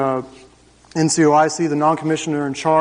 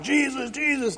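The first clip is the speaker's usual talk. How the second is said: mock fervent praise; 10 semitones higher, 5 dB quieter than usual. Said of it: "they're all like".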